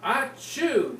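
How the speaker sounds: loud bear sneeze sound; big sneeze. Acted. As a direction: blow out a big achoo. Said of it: "and he goes".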